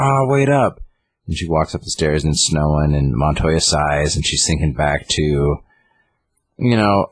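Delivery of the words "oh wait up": high, drawn-out imitated call; mock calling out. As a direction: call after someone leaving, in a raised pitch. Harley calling after the detective.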